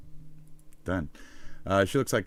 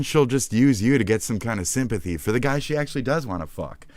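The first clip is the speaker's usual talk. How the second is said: usual speaking voice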